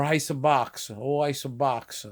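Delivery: slow "ice box" as "ice-a box-a"; Italianized "ice box" with added vowels; drawn-out Italian-American "ice box"; Italglish. Shown as a demonstration. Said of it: "So they say".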